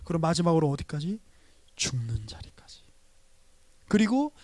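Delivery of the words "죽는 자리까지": preacher's dramatic whisper; hushed whisper. Acted. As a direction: drop to a breathy whisper on the last words. Sermon climax, death reached.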